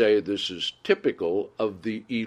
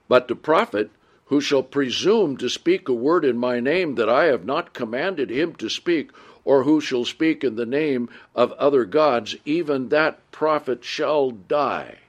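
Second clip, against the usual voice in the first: louder, measured Bible reading voice; scripture reading; 4 semitones higher, 3 dB louder than usual. Acted. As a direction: read the verses out to the congregation with weight. Reads scripture aloud.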